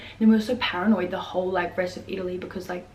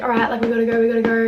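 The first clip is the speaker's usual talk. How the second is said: louder, higher call to get moving; acted-out impatient rallying call; imitated hurrying along; 4 semitones higher, 9 dB louder, faster than usual. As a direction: round up the group, raised voice. Acts out man hurrying them along.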